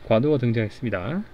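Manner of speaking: usual speaking voice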